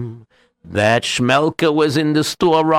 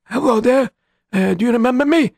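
put-on high old man voice, fast; lightly voiced old beggar; 12 semitones higher, faster than usual. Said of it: "a very old man".

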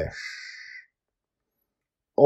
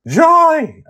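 very high, loud cry of reproach; yelled name; 16 dB louder than usual. A narrator's reading. Susie reacts to Joy throwing Justin overboard, yelling. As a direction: yell the name across the deck. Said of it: "I hear Susie yell".

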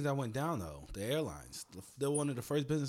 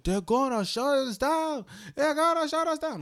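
annoying coworker; 13 semitones higher, 8 dB louder than usual. Retold or imitated. Coworker who couldn't shut up.

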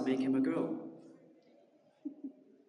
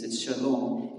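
usual speaking voice

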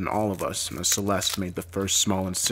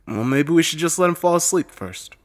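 Heather; 8 semitones higher, 5 dB louder than usual. A narrator's reading. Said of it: "Heather says".

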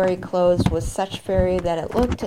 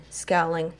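ordinary delivery, nothing marked

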